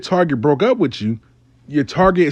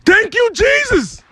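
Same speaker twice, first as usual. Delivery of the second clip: much higher, slow, shouted praise; overjoyed holler; slower than usual. Retold or imitated. Client overjoyed that Melvin Brown picked up, shouting. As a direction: shriek it like a prayer answered. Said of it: "hollering like".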